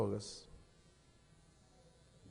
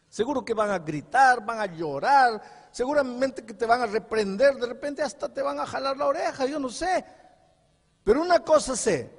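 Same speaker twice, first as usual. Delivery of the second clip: raised and pressing, rising to a peak; impassioned preaching; 9 semitones higher, 6 dB louder than usual. Acted. Pastor tells the pregnant girl her parents will help, impassioned. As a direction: preach with urgent conviction.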